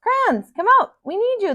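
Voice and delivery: put-on boy calling out. A boy calls out to the hidden crayons, high and loud. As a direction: call out high like a little boy searching. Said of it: "the boy declared to Brown".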